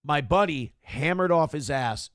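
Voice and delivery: ordinary talk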